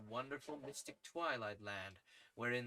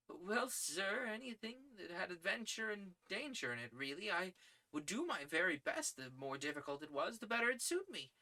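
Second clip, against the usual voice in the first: put-on lightly raised, eager young man; eager young reporter; 8 semitones higher than usual. Young reporter begging his editor for adventure, lightly voiced. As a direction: sound keen and earnest.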